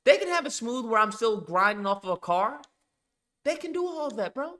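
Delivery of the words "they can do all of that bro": emphatic insistence. Real, not acted. To his chat about the game, high emphatic insistence. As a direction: pitch jumps way up, pressing the point.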